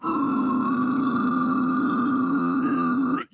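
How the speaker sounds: sharp loud breathy gasp. Retold or imitated. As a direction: gasp in hard before the name. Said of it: "It's more like".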